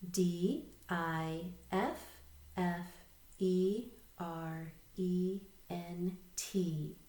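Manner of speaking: slow, letter-by-letter spelling; spelling aloud. Shown as a demonstration. Teacher leading learners in read, spell, read, spelling aloud. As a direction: spell it out slowly, a gap after each letter. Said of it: "read the next word together and spell it".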